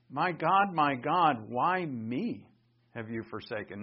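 why me said with weight on me, trailing off; emphasis on me. Shown as a demonstration. Preacher stressing the me in the cry. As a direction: lean on me in why me have you forsaken. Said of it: "I'm using voice inflection to emphasis".

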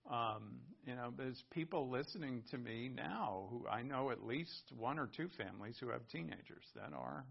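ordinary talk

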